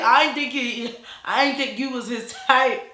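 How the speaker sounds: high, loud exaggerated imitation breaking toward laughter; mock brother voice. Brother remarking on her looks, hammed-up mock voice. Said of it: "he ain't talking like that".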